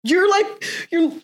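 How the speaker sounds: retold angry outburst, half laughing; mock rage. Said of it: "the rage".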